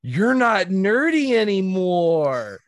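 high, slow, drawn-out gloating taunt; sing-song mock triumph; mock gloating wife. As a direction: stretch it out to rub it in. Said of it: "And she goes".

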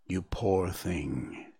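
gentle pity. Acted. The elder gently pities Gobo, soft and slow. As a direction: pity him tenderly, slowly. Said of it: "he said gently".

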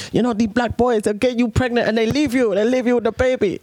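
put-on high-pitched scolding Malaysian mum accent. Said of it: "I will do the accent".

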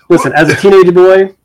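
emphatic. Real, not acted. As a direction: lean in hard on it. Emphatic aside about [name].

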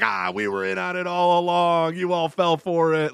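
lightly voiced smug villain reveal; hint of a wrestler gloating at the crowd; gloating heel.

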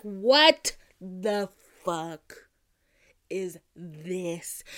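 can't believe what she's hearing; a long, loud stunned outburst; stunned disbelief. Retold or imitated. Shocked reply to therapist's diagnosis.

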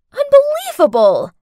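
high, loud exclamation of outrage; indignant outburst. Acted. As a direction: cry out at the injustice. Outraged at losing prize money.

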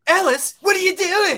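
high, loud yell of a needling instructor; imitated screaming. Driving instructor to teen student, screaming. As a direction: bellow it like an impatient driving instructor. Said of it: "he was, like, screaming at you".

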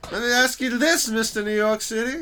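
high, loud mocking New York swagger; mock New York greeting. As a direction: tease him like a swaggering New York guy. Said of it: "this is the conflict of accents".

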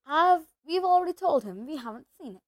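impatient, already told you; irritated. A narrator's reading.

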